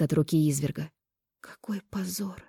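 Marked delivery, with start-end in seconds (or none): 1.4-2.4 slow, ashamed whisper; barely audible whisper. A narrator's reading. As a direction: breathe it out almost silently. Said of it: "прошептала чуть слышно".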